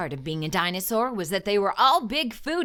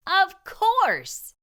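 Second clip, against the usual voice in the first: higher and louder eureka; thrilled storyteller exclaiming the answer; excited realization; 9 semitones higher, 4 dB louder than usual. A narrator's reading. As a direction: burst out with the discovery.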